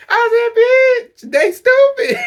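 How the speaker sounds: very high, loud laughing shout; screaming laugh. Real, not acted. Screaming with laughter at the friends. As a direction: scream it out while cracking up.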